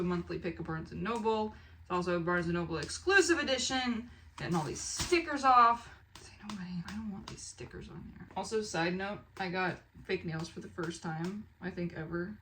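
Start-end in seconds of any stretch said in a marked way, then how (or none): 6.2-8.3 soft conspiratorial whisper aside. Real, not acted. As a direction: whisper as if sharing a secret. Peeling stickers, aside to viewers.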